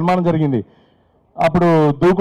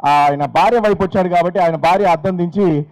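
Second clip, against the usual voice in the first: ordinary delivery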